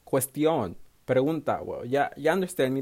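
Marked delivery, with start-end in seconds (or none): none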